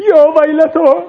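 high, sobbing wail; crying out. Acted. Preacher voices Zainab's loud crying lament. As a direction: wail it through tears.

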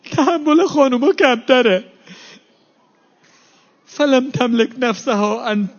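high-pitched mourning sermon voice, pleading; rising lament preaching. Acted. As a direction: lift the voice into a grieving rowza chant.

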